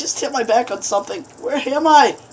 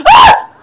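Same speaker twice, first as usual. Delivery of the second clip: sudden high shriek of fright; startled scream; 12 dB louder than usual. Real, not acted. Player caught off guard by the monster, startled shriek. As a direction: jump out of your skin and shriek.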